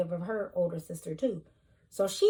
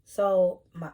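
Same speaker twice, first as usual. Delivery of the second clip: ordinary talk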